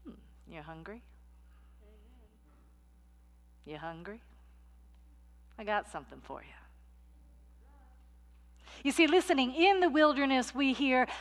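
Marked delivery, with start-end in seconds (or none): 0.1-6.5 soft, sly tempting murmur; coaxing tempter. Acted. The wilderness voice tempting a hungry soul, soft and sly. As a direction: lure them in gently.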